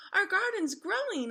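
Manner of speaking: high, loud happy cry; child's gleeful call across the garden; excited yell. A narrator's reading. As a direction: shout it out with joy. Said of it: "I yelled".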